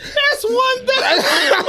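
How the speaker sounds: very high, stretched, squealing through laughs; co-host crying with laughter over the dust argument; howling laughter. Real, not acted. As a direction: barely get it out for laughing.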